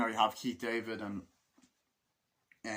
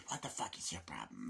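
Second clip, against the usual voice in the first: whispered snarl; 7 semitones lower, 9 dB quieter than usual. Retold or imitated.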